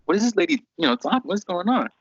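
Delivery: high, incredulous thinking aloud; high puzzled what-is-going-on; baffled inner voice. Retold or imitated. Inner thought, baffled by her.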